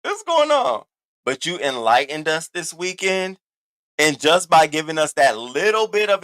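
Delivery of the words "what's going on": mock confused. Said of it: "looking at you like".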